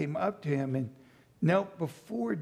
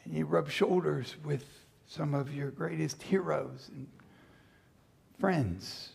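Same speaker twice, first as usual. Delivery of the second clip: usual speaking voice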